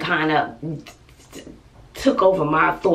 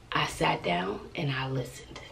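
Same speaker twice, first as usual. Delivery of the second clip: softer, almost whispered; 3 semitones lower, 11 dB quieter than usual. Real, not acted. Mother telling viewers she stayed calm, whispered. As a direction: breathe the words out gently.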